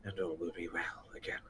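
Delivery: whispered, fast and soft; soothing whisper. Acted. Gorthrak soothing the trapped kobolds in a whisper. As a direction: breathe the promise gently.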